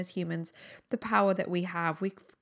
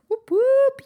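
high, loud whooping cheer; excited cheer; 11 dB louder than usual. Real, not acted. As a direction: whoop it up to celebrate. Cheering in the new year.

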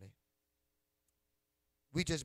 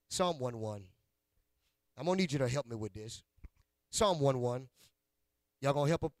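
ordinary talk

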